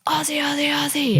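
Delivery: high-pitched mock yell, held back in volume; imitated crowd yell. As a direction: call it out like starting a chant from the back. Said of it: "one of the Aussies at the back just yells".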